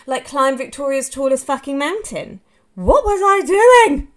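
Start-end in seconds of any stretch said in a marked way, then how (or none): 2.7-4.1 loud, high, slow cry of disbelief; loud self-reproach, drawn out; exasperated outburst. Real, not acted. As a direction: belt out the question at yourself, slowly. Exasperated at her own choices.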